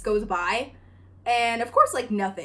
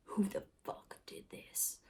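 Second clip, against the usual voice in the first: slow, much softer unvoiced threat; dad quietly demanding answers; menacing whisper; 14 dB quieter than usual. Dad demanding in family meeting.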